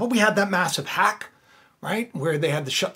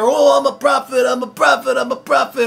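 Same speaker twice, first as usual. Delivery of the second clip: raised-voice mimicry of would-be prophets; mock self-important; 5 semitones higher, 5 dB louder than usual. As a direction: parade yourself as a prophet, mockingly. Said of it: "taking themselves so seriously".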